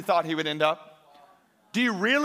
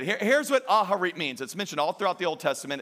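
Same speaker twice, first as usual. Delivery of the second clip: usual speaking voice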